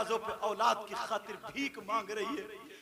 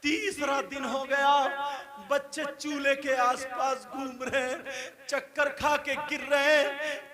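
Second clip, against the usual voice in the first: full-voiced chanted sermon with drawn-out line endings; ringing, melodic pulpit storytelling; sing-song preaching; 3 semitones higher, 13 dB louder than usual. A narrator's reading. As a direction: intone the tale fervently to a big crowd. Sustained chanting sermon at full force.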